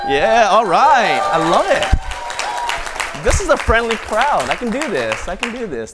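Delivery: delighted host. Real, not acted.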